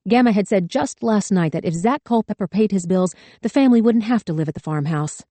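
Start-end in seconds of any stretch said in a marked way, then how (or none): none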